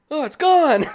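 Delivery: high and loud, amazed; kid's surprised gasp. Retold or imitated. Kid in the video realizing his tooth is gone, amazed. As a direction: marvel like a kid whose tooth just popped out.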